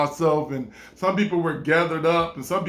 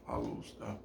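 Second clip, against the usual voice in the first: soft whispered aside; 8 semitones lower, 18 dB quieter than usual. Real, not acted. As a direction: whisper it to yourself. Repeated line, whispered aside.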